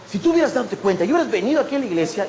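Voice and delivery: fast, reproachful rush of words; hurried scolding. Retold or imitated. Supervisor scolding volunteer on the phone, hurried scolding. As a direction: imitate a rushed telling-off. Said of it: "me habló así con ese tono, con esas prisas me habló".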